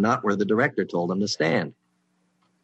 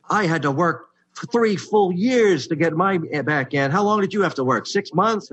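whiny complaint; 9 semitones higher, 4 dB louder than usual. Retold or imitated. Rival writer griping about his three years of work, high and whiny. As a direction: whine it like a wounded colleague.

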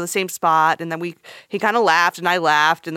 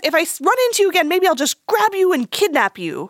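put-on joking older man; 9 semitones higher than usual. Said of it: "And he says, he says".

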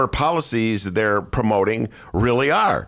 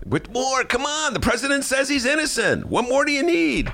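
much higher, mocking voice; mocking sarcasm. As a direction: ham up the smug columnist, high and scornful. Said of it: "he's a funny".

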